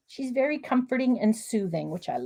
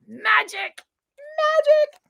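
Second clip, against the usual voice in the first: sing-song magic; 13 semitones higher, much slower than usual. Real, not acted.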